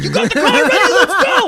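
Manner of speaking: high, loud, laughing urgent yell; hammed-up panicked teammates; frantic players. Retelling game lobby players rushing him, loud laughing mock panic. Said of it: "they're like".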